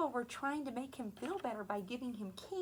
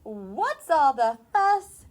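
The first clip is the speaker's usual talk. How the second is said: loud, slow mother owl voice; a big booming mama owl; 2 semitones higher, 15 dB louder, slower than usual. Acted. Mother owl returns in story.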